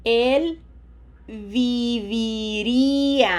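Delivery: slow Spanish model with long held I sounds; lengthened vowels. Shown as a demonstration. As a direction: draw out each vowel for the learner. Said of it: "it is easier to lengthen the vowels".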